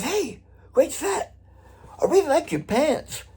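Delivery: high, slow, airy imitation of a rock star's praise; high mock voice. [name] complimenting the drummer's pants, in a high slow mock voice. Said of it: "and he goes".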